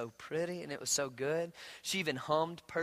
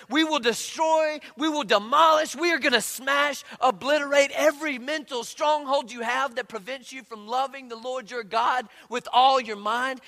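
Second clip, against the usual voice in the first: triumphant proclaiming; 9 semitones higher, 7 dB louder than usual. Acted. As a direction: proclaim each verb like a war cry. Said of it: "It says".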